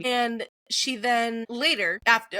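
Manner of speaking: ordinary delivery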